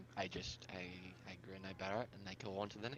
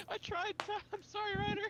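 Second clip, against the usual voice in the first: very high, helpless laughing squeal; laughing apology; 4 dB louder than usual. Real, not acted. Player apologizing to Ryder for the natural one, laughing hard. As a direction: squeak it out through real laughter.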